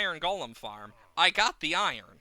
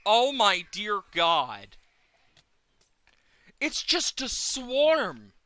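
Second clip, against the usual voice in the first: dismayed; 4 semitones higher, 6 dB louder, slower than usual. Real, not acted. Swarm appears.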